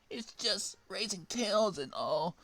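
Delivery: Sonic voice. Acted. Sonic excusing himself to Amy, mock crying. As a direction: whine it out sheepishly. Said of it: "said Sonic, crying".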